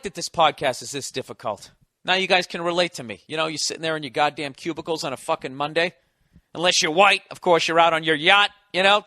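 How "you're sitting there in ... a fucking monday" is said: usual speaking voice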